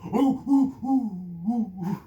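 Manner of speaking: high, loud hooting like a monkey; imitated monkey hoots; monkey howls. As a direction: howl like jungle monkeys in the night. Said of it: "it's, like, howling".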